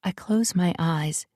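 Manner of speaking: plain reading voice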